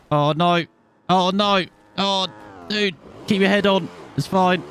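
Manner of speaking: high-pitched alarmed yelps; real mid-race panic, high. Real, not acted. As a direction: react to a near crash, pitch jumping up. Near crash during race.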